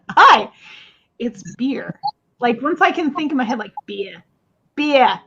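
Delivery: mock Australian, "beer" as "bee-ah"; a light Aussie twang on "beer"; Australian accent. Showing her friend her Australian word, light Australian accent. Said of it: "My Australian word".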